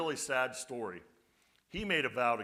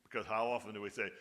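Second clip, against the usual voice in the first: ordinary talk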